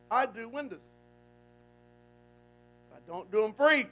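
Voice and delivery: a playful, teasing God voice. Acted. Preacher voicing God teasing Caleb, loud then hushed. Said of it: "God says".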